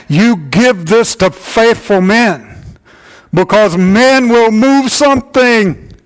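rally the men from the pulpit; preaching at full force. Acted. Pastor urging the men of the church, preaching at full force.

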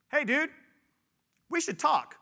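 high, loud "hey dude" call-out; retold casual summons, loud and high; mock casual confrontation. Confronting his friend.

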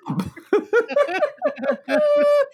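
high-pitched cackling laugh. Real, not acted. Big laugh at joke.

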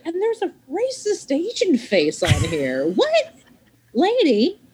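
rising disbelief, pitch climbing to a high Lady; mock-shocked call-out over the mic; high incredulous what, lady; incredulous confrontation. Confronting woman over microphone.

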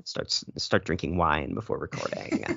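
slow, low creaky fry; lazy vocal fry drawl. Pretend. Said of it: "I need to channel my inner Dasha".

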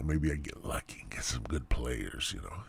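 whispered to himself; hopeful whisper. Retold or imitated. Gamer quietly hoping for good teammates, whispered. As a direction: hush it like a private hope.

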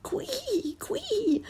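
very high squealing quee; pig squeal. A narrator's reading. Pig lady squealing as she falls. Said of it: "Missus Twistytail squealed".

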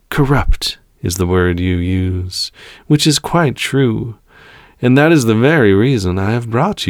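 smooth, superior villain voice; calm villain. Acted. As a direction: toy with your prisoner, drawing out words. Villain persuades his prisoner.

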